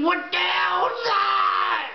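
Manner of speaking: a shocked, high shout of alarm. Retold or imitated. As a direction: yell it out at the strange device. Shocked at the alien device.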